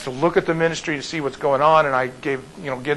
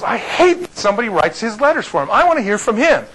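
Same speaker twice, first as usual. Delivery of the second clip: retold indignation, raised pitch; wife going haywire; 7 semitones higher, 4 dB louder than usual. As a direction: imitate her worked-up complaint, higher voice. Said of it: "she's getting all haywire".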